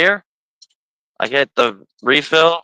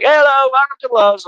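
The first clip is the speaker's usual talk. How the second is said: higher chirpy cashier greeting; greeting clerk; 10 semitones higher, 4 dB louder than usual. Retold or imitated. Host imitating Love's cashiers greeting customers, higher chirpy voice. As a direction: sing out the store greeting. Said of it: "They're like".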